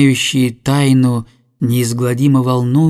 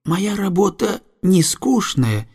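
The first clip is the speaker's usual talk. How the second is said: put-on quoted young girl; 7 semitones higher than usual. A narrator's reading. Girl tells the coalman about her work, girlish voice. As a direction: speak up high and lightly, confiding.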